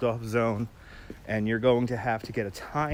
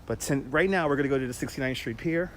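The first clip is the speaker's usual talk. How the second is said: ordinary talk, nothing marked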